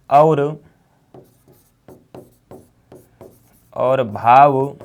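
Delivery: slow dictation in broken-up phrases. Acted. Teacher dictating notes to students, slow dictation. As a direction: read it out piece by piece for note-taking.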